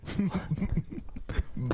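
breathy burst of laughter; unvoiced giggle. Real, not acted. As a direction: laugh at what you read.

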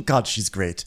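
normal talking voice

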